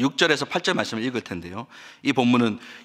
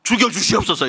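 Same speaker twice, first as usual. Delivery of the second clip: raised, higher-pitched mock plea in a temper; hot-tempered outburst; 6 semitones higher, 3 dB louder than usual. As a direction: act out Jonah's heated outburst. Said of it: "열받으면 어떻게 합니까". (욱해서 내뱉는 말투)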